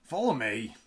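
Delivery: a guard shouting an order on the run; shouted. A narrator's reading. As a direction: call it out as you rush off.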